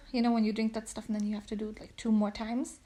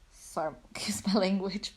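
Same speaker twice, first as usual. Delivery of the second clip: laughing whisper. Real, not acted. Apologizing for swearing.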